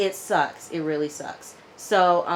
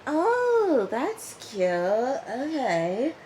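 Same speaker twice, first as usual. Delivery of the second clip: fake politeness; 8 semitones higher, much slower than usual. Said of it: "She was like".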